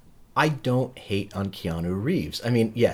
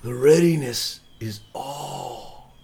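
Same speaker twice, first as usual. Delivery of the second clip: slow, higher, hammed-up Keanu doing Shakespeare; mock Keanu Hamlet; 5 semitones higher, 3 dB louder, much slower than usual. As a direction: drag it out like a lost surfer playing Hamlet.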